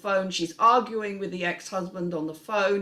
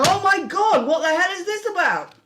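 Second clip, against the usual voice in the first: much higher, louder shocked gasp with a laugh; shocked audience; 11 semitones higher, 7 dB louder than usual. Acted. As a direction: gasp it out in disbelief, half laughing. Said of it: "you've got people going".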